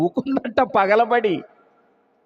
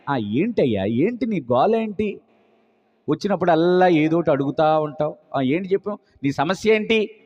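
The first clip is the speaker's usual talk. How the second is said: 1 irritated God; 7 semitones lower, 3 dB quieter than usual. Acted. God voiced as irritated father.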